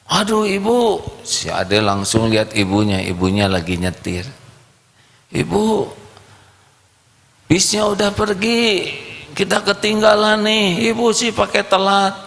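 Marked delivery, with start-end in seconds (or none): 0.1-1.0 put-on high whiny kid voice; a little boy complaining to mum; child's voice. Little boy whining to his mother about the missed bus, high child voice. 5.3-12.2 put-on high, whiny little boy; child's voice. Boy whines to his mother they missed the bus, high child voice.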